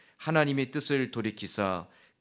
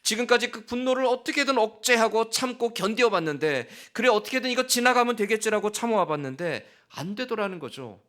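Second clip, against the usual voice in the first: preaching climax; 10 semitones higher, 5 dB louder than usual. Real, not acted. Preacher building passionate climax.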